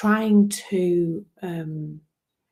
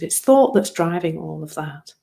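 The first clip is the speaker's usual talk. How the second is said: usual speaking voice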